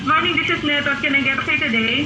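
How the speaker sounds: normal talking voice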